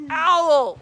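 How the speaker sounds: high, loud, slow shouting of the name; very loud call. Acted. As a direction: call out to Owl at the top of your voice. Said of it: "he called out in a very loud voice".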